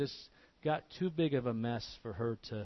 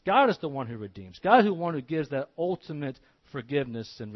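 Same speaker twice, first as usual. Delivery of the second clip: louder preaching, hammering the word God; loud sermon climax; full-force preaching; 6 dB louder than usual. Acted. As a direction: build the proclamation of God's forgiveness.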